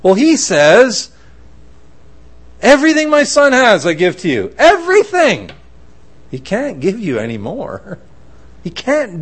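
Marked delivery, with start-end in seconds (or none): 2.6-5.5 high, ringing proclamation; emphatic declaration. Acted. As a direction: proclaim a lavish gift, punching EVERYTHING. God bestowing all, quoted.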